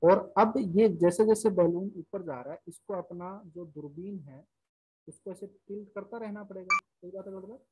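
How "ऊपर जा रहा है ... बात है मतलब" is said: trailing off too softly to hear; barely audible. Real, not acted. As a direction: voice drops almost to nothing. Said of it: "sir, आपकी आवाज कम आ रही है".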